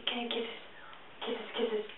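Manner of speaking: whispered coaxing question. Real, not acted. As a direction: hush it to a breathy whisper for the cat. Asks cat for kisses.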